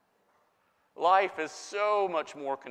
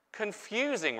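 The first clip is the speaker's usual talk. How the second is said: usual speaking voice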